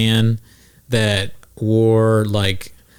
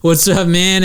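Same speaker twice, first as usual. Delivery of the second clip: retold improv greeting; 8 semitones higher, slower than usual. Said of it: "some weird improv thing where they go".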